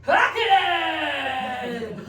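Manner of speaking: very high, loud mock yell; hammed-up mum yelling oi. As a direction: shout it at the terrorist like a fed-up mum. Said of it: "she would yell out".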